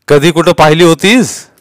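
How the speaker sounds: loud, high question; stern interrogator; police inspector. A narrator's reading. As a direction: keep pressing the witness.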